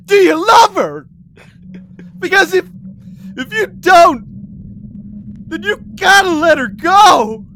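high, loud, crying plea; pleading shout. Acted. Boy begging his rival to let Allie go, a crying shout. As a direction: beg him with a breaking yell. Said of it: "you pleaded".

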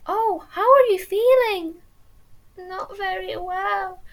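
high, slow mock American voice; imitating her Californian friend, high and slow; American accent. Quoting the Californian friend caring for her, American accent. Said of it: "I won't do the American accent".

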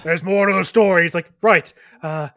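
loud, dopey orc voice; dopey cartoon bellow. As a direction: boom it out like a dim orc. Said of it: "And then the orc says".

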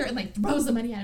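ordinary talk

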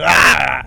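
loud drawn-out mock scream; hammed-up scream of pain. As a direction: imitate a cry of pain, loudly. Imitates reaction to slit vein.

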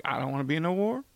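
mock a coward who won't go to war, rising to a high squeak; mock whiny draft dodger. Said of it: "Your dude at home, like".

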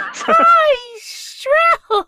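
squeaking as high as possible while laughing; high shrill voice. Pretend. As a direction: strain up to a tiny shrill squeak. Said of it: "Higher, higher".

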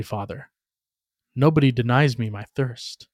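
plain reading voice

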